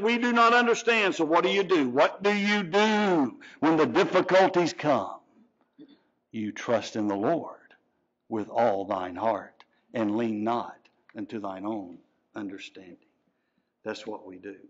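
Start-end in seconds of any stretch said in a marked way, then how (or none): 6.3-14.6 low, soft, intimate sermon voice; hushed preaching. Acted. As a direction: draw them in close and quiet. Quiet intimate sermon passage.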